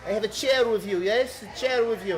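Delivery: put-on high, loud Eastern European accent. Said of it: "default Eastern European accent".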